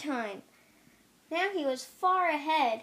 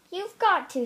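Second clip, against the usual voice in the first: insistent friends; 5 semitones higher, 6 dB louder than usual. A narrator's reading. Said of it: "said his friends".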